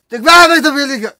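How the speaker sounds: fast, loud excited gush; excited praise. Real, not acted. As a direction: rattle it off excitedly.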